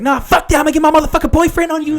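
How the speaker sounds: high, loud, fast sassy rant; mock an irate passenger going off.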